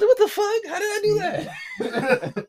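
high, laughing "what the fuck"; laughing disbelief. Retold or imitated. Telling about the wrong address, laughing high. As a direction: crack up at your own mistake. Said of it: "was like".

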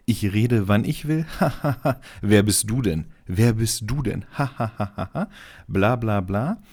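mocking aggressive. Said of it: "Sätze wie zu einer Polizistin".

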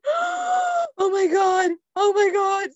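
high, loud horrified "oh my God"; panicked gasp. Retold or imitated. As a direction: shriek it in mortified shock, stretched out. Panicked reaction to her own fart.

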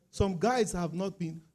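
ordinary talk, nothing marked

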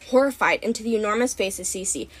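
plain reading voice

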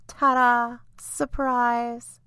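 spring a merry surprise; playful surprise. Acted. Fairy revealing herself to frightened Lucy, playful surprise.

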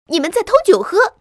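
high, loud squeal of accusation; shrieking. A narrator's reading. Mouse catches them stealing cider, shrieking. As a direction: shriek at the thieves. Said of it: "老鼠尖叫道".

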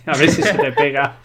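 chuckling as you tease him; laughing. Real, not acted. Teasing the guest about picking up accents, laughing.

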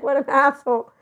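high, loud, amused outburst; laughing disbelief. Retold or imitated. Reacting to a friend's gossip, amused disbelief. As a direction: retell your scoffing reaction, fresh from a laugh.

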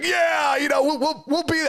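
a proud father's high, excited shout; excited dad. Retold or imitated. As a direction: whoop it out like a thrilled dad on the phone. Voicing his dad's delighted reply.

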